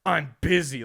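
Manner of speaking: loud, stern brush-off after all friendliness; mock stern dismissal, punched out; Yodel kid. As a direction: imitate a kid sternly shutting someone down. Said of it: "It was so stern".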